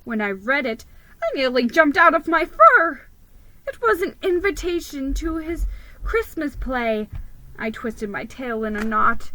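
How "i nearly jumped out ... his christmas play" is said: much higher, louder shocked narrator; startled. A narrator's reading. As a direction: jolt up in alarm.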